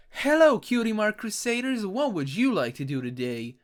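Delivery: high, sweet cartoon filly greeting; high cartoon pony voice. Reading comic dialogue aloud.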